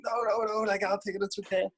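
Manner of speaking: high-pitched, hurried protest; retold awkward, high-pitched insisting; flustered polite refusal. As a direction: wave off the help in a high voice. Flustered refusal to the bag carrier.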